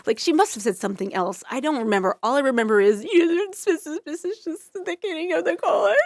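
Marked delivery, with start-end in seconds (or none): 3.0-6.0 high, jumbled mock-nervous doctor; trembling. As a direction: imitate a rookie stumbling through bad news. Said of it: "she's already trembling".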